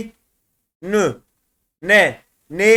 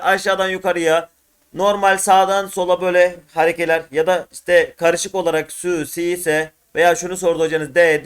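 ordinary talk

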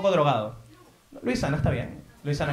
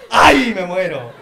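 laughing exclamation; 2 semitones higher, 6 dB louder, much slower than usual. Real, not acted. Host reacting to flirt story.

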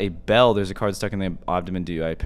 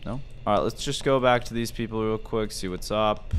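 usual speaking voice

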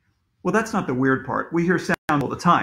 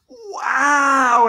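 high, loud, long exclamation; amazed shout; 9 semitones higher, 6 dB louder than usual. A narrator's reading. Everybody exclaims on finding Mister Will, amazed shout. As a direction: belt it out in amazement.

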